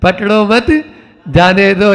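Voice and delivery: "पकड़ो मत" rising as one phrase, then "जाने दो" falling; pause after मत. Shown as a demonstration. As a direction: join मत to पकड़ो, break before जाने. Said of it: "बस इतना ही अंतर है". (पकड़ो मत के बाद विराम)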